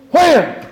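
sudden high, loud whack noise; sharp vocal smack; imitated whack.

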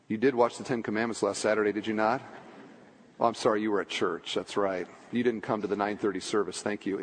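low, quick, quiet mock scolding; soft, dropped-pitch kidding of the congregation; dry teasing aside. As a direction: toss off a deadpan joke under the sermon.

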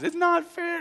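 high-pitched mock whine; whining child. Daughter protesting to dad, mock tearful whining. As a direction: whimper it like an aggrieved little kid. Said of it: "It's just tears and whining".

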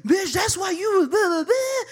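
high-pitched ranting gibberish; mock livid boyfriend. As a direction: imitate his furious rant, voice way up. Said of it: "so he's now livid".

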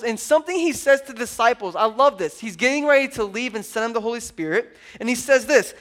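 ordinary delivery

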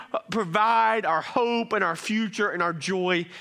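loud, high-pitched sermon delivery; preaching at full force. Real, not acted. Preacher urging the congregation to trust God, full force. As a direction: preach it loudly with rising conviction.

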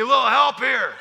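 pressing for help under the bar; urgent plea. Retold or imitated. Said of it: "This guy says to me".

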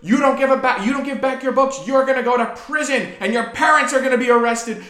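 high, loud outraged villain; cartoon villain outrage. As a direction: shriek it like a cartoon baddie. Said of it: "like a cartoon villain's voice".

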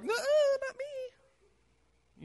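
very high, very slow squeaky voice; hammed-up frightened whine; mock scared squeak. Peter cowering when questioned.

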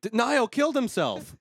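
high-pitched mock disbelief; mock shock. Host acting out shocked viewers, mock shock. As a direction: blurt it out as scandalized fans. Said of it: "people would be like".

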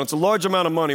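normal talking voice

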